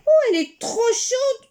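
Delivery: put-on old man crying out, too hot; sharp cry of surprise; cried out. Old man cries out the cake is too hot. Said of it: "crie le vieux monsieur".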